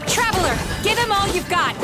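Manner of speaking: high, urgent call to an ally; urgent battle cry. Acted. Heroine urging the Traveler to attack, urgent.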